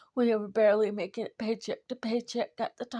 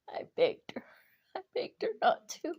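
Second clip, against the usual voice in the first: crying; 7 semitones higher than usual. Real, not acted. Grieving speaker retelling loss.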